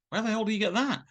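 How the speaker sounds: high-pitched, amazed question; retold astonishment. Director shown photos he had never seen, astonished. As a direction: ask it high, in mock bafflement.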